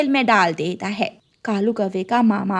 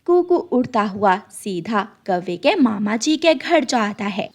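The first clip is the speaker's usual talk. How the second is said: ordinary delivery, nothing marked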